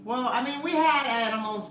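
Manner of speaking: usual speaking voice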